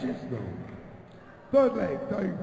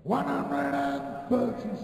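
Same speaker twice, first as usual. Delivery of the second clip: darts score caller; 5 semitones higher than usual. Real, not acted. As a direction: stretch the number out like a darts caller.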